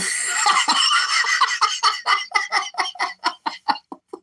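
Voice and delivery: long breathless wheezing laugh; real laughing fit. Real, not acted. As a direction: burst into helpless, drawn-out laughter. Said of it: "I can't stop laughing".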